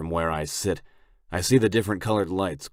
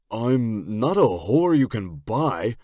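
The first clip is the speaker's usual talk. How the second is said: indignant; 6 semitones higher, 7 dB louder, slower than usual. A narrator's reading. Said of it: "Toole states with a flush".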